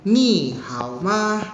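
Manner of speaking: "Ni hao ma" drawn out slowly, ni and hao in third tone, ma short and toneless; Mandarin tones, very slow. Shown as a demonstration. Teacher modelling Mandarin greeting for class, very slow. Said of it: "ni-nya nada ketiga di sini ya".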